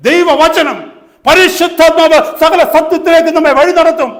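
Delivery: loud, high preacher's shout; full-force preaching. Real, not acted. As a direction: thunder it out to the congregation.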